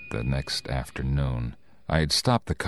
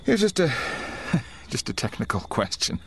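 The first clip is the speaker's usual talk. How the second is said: nervous denial. Acted. Nervous driver denies to gas attendant.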